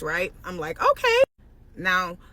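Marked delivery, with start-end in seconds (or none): 0.8-1.2 high, loud sarcastic okay; a pitched-up knowing okay; mock-surprised okay. Sarcastic okay on seeing her plans.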